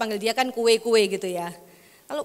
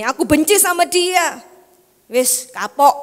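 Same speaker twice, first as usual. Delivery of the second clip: a touch of hatred, louder; voicing a spiteful grudge, a bit louder; mock spite; 4 semitones higher, 5 dB louder than usual. Preacher voicing a spiteful grudge, mock anger. Example of hateful words.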